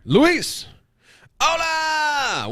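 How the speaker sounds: high call of "Luis! Hola!"; hype greeting. Real, not acted. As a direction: shout a welcome to a newcomer. Greeting new viewer Luis.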